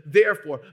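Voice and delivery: the preacher driving home "therefore", loud; stressed therefore. Acted. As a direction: land hard on "therefore". Preacher stresses key word.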